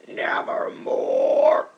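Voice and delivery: slow, eerie, voiceless whisper; raven's word. Acted. The raven answering the narrator's question, slow eerie whisper. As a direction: draw it out like an uncanny bird. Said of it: "Quoth the raven".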